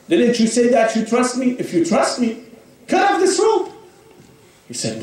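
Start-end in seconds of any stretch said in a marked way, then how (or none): 0.1-3.7 commanding shout. A narrator's reading. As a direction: demand he cut the rope, building to a shout. God demands proof of trust.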